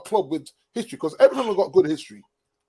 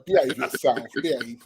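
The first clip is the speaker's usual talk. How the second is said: grumpy Roy Keane; 5 semitones lower, slower than usual. Retold or imitated. Co-host imitating the pundit telling someone to behave, grumpy. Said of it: "he's just a grumpy old man".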